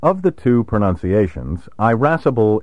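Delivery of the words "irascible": irascible begun like the word "eye"; long initial I. Shown as a demonstration. Narrator naming the older of two pronunciations, long initial I in irascible. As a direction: stretch the first vowel of irascible to "eye". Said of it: "reflects the quality of the vowel".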